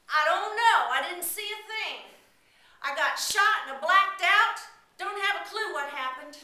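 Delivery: high, loud insistent denial; loud lying cop; wounded cop denying. Acted. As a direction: play dumb emphatically. Wounded cop covers for boy.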